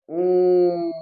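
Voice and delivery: ओम drawn out long and loud; prolonged Om. Shown as a demonstration. Teacher demonstrating a pluta vowel to students, drawn-out call. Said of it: "क्या बोले?".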